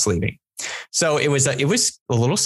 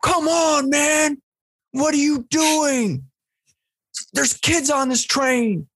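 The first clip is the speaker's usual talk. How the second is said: guy scolding a smoker; 13 semitones higher, slower than usual. Retold or imitated. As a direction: call out the smoker, high and indignant. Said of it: "And the guy turns around and goes".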